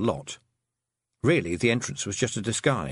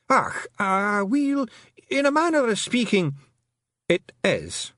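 much higher Scottish pixie, caught out; flustered Feegle; 11 semitones higher, slower than usual. Acted. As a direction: Rob Anybody squirming under questioning. Rob Anybody caught out.

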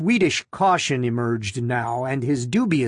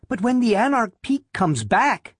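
put-on higher-pitched, insistent young believer; Loretta; 8 semitones higher than usual. A narrator's reading. Loretta defending her belief, higher-voiced and insistent. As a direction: pitch it up and press the point. Loretta argues back in dialogue.

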